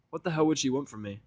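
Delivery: ordinary delivery, nothing marked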